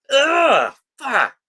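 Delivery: loud, high groan of disgust; recoiling "ugh" and a curse; cringing groan. Real, not acted. Cringing at the quoted line.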